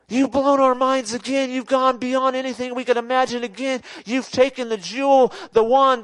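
high, fervent cry of worship; overwhelmed angels. Acted. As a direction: exclaim to God in astonished adoration. Angels exclaim to God in amazement.

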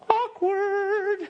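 high squeaky mock awkward. Preacher mimicking the congregation's awkward reaction, high squeaky. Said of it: "you're like".

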